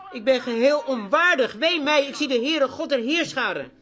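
loud, high, ringing cry of woe; fervent shout from the pulpit; preaching at full force. Acted. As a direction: thunder out the prophet's lament. Preacher crying out Isaiah's words.

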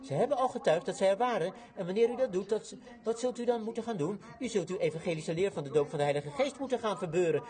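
ordinary talk, nothing marked

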